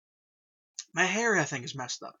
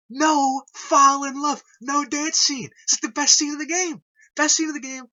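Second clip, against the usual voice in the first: excited loud praise; 4 semitones higher, 8 dB louder than usual. Real, not acted. Fan raving about the dance scene, loud and excited. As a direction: gush with full volume.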